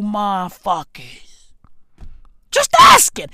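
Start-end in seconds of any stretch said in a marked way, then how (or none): 2.5-3.3 high, loud burst; defensive yelp. Real, not acted. Comedian defending his question to followers, defensive high yelp.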